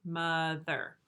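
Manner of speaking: "mother" divided into two drawn-out parts; syllable by syllable. Shown as a demonstration. Teacher repeating the chin test, "mother" syllable by syllable. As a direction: stretch and separate both halves of "mother". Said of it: "because it has two word parts".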